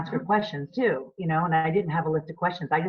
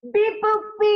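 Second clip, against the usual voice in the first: high beeping robot, louder; toy robot voice; 6 dB louder, slower than usual. Pretend. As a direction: beep it out like a little toy robot. Robot sounds ending the show.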